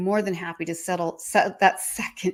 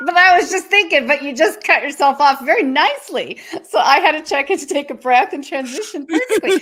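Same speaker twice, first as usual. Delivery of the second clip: laughing; 6 semitones higher, 6 dB louder than usual. Real, not acted. Laughing playful teasing of cohost.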